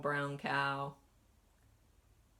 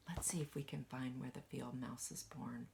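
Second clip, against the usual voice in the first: soft conspiratorial whisper; 4 semitones lower, 10 dB quieter, faster than usual. Acted. Teacher whispering to children while hunting the picture. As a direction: lean in and whisper the search.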